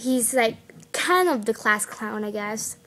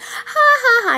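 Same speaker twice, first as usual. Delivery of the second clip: high, loud re-enacted laugh; big spelt-out ha-ha at someone's bad luck; mocking laugh; 13 semitones higher, 11 dB louder than usual. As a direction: imitate laughing your head off. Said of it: "laughing my head off".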